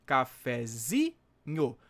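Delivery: Cafezinho slowly, with ZI drawn out and stressed; slow teaching demo of Cafezinho, long stressed ZI. Shown as a demonstration. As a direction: break Cafezinho into slow syllables, leaning on "zi". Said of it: "sílaba tônica tá aqui no zi".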